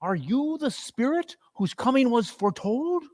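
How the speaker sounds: high, hushed wondering question; awed Scrooge. Acted. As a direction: ask the spirit in awe.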